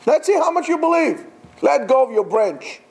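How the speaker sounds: challenging repeat. Retold or imitated. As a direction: dare him to prove it. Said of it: "repeated line".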